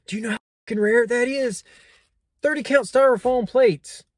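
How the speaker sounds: high-pitched, loud mock amazement; sarcastic hype. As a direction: oversell it as a priceless find. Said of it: "The sarcasm, I hope, is not lost".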